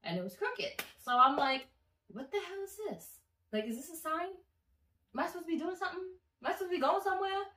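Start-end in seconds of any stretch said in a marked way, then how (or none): 2.1-7.4 high, puzzled questions; retold bafflement. Narrator wondering about the strange bill, high baffled questions. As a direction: pitch it up as if searching for a sign.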